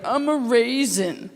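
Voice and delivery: put-on slow, proud child announcing a costume; six-year-old kid. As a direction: imitate a little kid showing off, drawn out. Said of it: "I'm six years old walking around".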